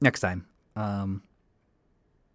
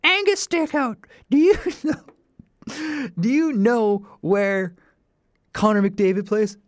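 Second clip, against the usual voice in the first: much higher, slow mock teacher voice, louder; hammed-up sassy teacher comeback; imitating Bev; 11 semitones higher, 6 dB louder, slower than usual. Teacher Bev fires back at a cheeky student, high mock voice. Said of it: "And she looks at me".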